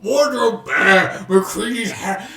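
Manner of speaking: lumbering monster grunt; Frankenstein's monster voice. Acted. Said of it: "you sounded like Frankenstein's monster".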